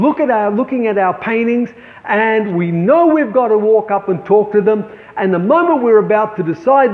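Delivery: ordinary talk, nothing marked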